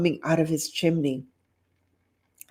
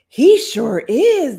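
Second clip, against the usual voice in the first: slow cowboy drawl; 8 semitones higher, 8 dB louder, slower than usual. Acted. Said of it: "the cowboy nodded in agreement".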